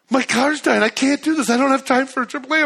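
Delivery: mock alarm as the car dies; retelling the car dying on the way to speak, panicked.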